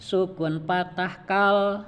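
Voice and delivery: slow, clear "Sukun patah kal"; teacher modelling "Sukun patah kal"; slow spelling drill. Shown as a demonstration. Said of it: "Saya dulu, Pak, ya".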